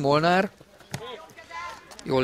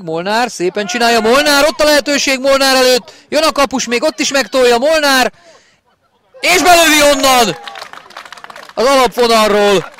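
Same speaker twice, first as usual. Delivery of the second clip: rising, louder play-by-play into a goal; excited hungarian sports commentary; 10 semitones higher, 7 dB louder than usual. Real, not acted. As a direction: build the chance, burst on the goal. Live goal call.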